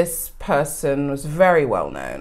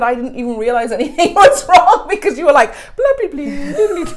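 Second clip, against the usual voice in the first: high, laughing impression of a friend; friend's chirpy blah blah, laughing; laughing mock quote; 8 semitones higher, 4 dB louder than usual. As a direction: giggle through a friend's breezy brush-off. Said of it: "so she said".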